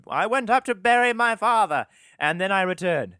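put-on loud, high-pitched dopey kid voice; dumb kid. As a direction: proudly report it like a clueless child. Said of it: "we have some dumb motherfucking kids".

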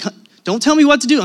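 high, loud, fast retort; imitating an offended wife, firing back; indignant. Wife rebuffs husband's draft advice, indignant.

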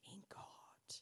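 sermon whisper. Real, not acted. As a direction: whisper it, intimate. Sermon drops to emphasis.

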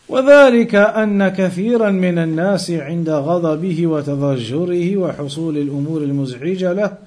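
rapid reading of an Arabic commentary; fast Arabic text reading. Acted. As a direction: rattle through the Arabic passage, fast.

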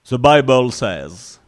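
put-on slow, English-accented preacher line; mock English preacher accent. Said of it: "on prend l'accent anglais".

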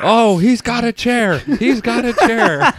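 loud, high, drawn-out ringside alarm; mock wrestling commentator. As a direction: call the family brawl like a wrestling match. Mock wrestling commentary on family fight.

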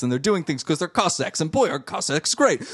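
normal talking voice

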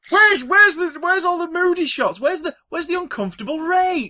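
high, loud mock complaint; hammed-up fan outrage; ranting old fan. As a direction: whine like a let-down fan. Mocking old fans' complaints.

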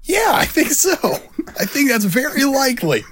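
high, smug mock Kevin Smith. Cohost as Kevin Smith, smugly boasting to a fan. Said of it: "You're Kevin Smith".

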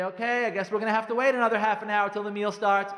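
mock a husband laying on the guilt; blaming. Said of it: "That's blaming".

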